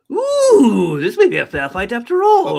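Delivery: high, loud, gleeful villain voice; Joker impression. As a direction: relish it like a madman. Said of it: "he did the Joker".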